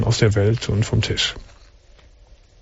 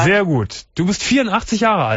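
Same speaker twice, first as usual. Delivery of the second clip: pitched much higher to carry; speaking up; 7 semitones higher than usual. Real, not acted. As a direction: project up for the caller. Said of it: "Du musst schon ein bisschen lauter reden".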